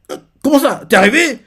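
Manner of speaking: shocked. Acted. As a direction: react in shock. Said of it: "Elle est choquée". (stupéfaite, voix aiguë et forte)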